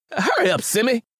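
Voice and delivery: high, urgent call; urging. Acted. Willie tugging Simi's arm, high urgent call.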